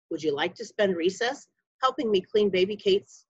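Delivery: ordinary delivery, nothing marked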